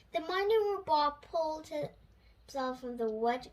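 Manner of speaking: ordinary delivery, nothing marked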